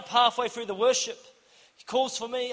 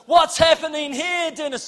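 high, louder startled question; alarmed demand; 7 semitones higher, 6 dB louder than usual. Retold or imitated. Pastor runs over to the commotion, alarmed question. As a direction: burst in asking, astonished.

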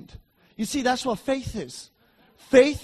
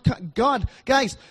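ordinary delivery

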